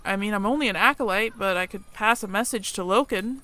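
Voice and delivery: ordinary delivery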